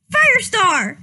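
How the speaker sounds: loud, high call of a name; a young cat yelling for her crush; yelled name. Acted. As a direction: shout it across the camp. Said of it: "she yelled".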